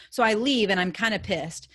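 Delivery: plain reading voice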